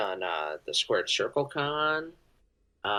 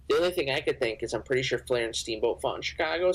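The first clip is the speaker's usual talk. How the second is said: ordinary talk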